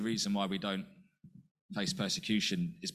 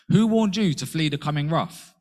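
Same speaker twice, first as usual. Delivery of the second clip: the Baptist's rebuke, much louder and higher; stern prophet; 5 semitones higher, 9 dB louder than usual. Retold or imitated. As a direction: thunder it at the Pharisees. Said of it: "he says to the Pharisees".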